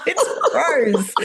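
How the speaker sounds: high, laughing exclamation; amused disbelief. Real, not acted. Coach to fellow actor about prose in metre, laughing disbelief.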